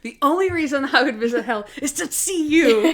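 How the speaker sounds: Monk telling Harold he'd visit hell to see him, laughing; playful Monk quote. Retold or imitated. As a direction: deliver it as a cheeky insult. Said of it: "Monk wants to go to hell".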